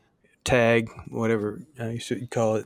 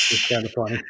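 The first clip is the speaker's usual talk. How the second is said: ordinary delivery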